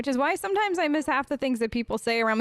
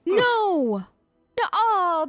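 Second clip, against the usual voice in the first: shocked disgust; 7 semitones higher, 4 dB louder, much slower than usual. Real, not acted. Disgusted reaction to golem story.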